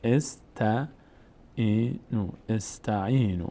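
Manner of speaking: very slow استعینو broken into "es... ta... 'ii... nu"; drawn-out syllables in استعینو, starting with short "e"; kasra opening, sounded out. Shown as a demonstration. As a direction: repeat استعینو for learners, opening on "e".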